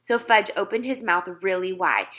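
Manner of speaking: usual speaking voice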